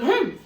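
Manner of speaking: a loud, high copycat huff; little humph. Acted. Young kangaroo copying its mother, loud high huff. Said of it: "in her pouch said".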